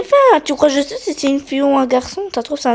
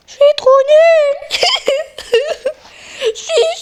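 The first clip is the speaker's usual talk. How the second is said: squeaky character voice. Pretend. Kid voicing game character.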